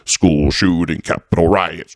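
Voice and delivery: low mechanical roll-call of events; clockwork listing. Retold or imitated. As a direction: drop low and reel them off like a machine. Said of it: "rolling them out like clockwork".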